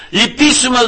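slow, high pleading demon voice; demons begging. Retold or imitated. Preacher voices demons begging Christ for mercy. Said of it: "να παρακαλάει και να λέει".

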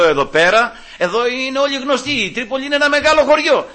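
acted-out urgent plea, pitched high; retold urgent warning. Talking an angry brother out of a fight, urgent.

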